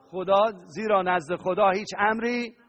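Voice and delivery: ordinary delivery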